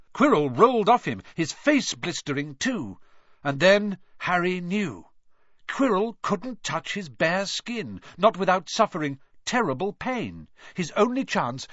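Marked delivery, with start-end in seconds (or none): none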